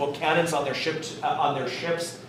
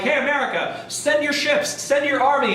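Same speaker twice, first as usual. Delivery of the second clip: mock France pleading; 9 semitones higher than usual. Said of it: "So France is like".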